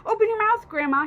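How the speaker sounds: put-on urgent boy with a jug of water; cried out. A narrator's reading. Boy urges grandma to open her mouth, loud. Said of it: "he cried".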